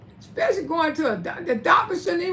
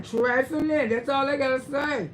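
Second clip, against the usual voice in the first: ordinary delivery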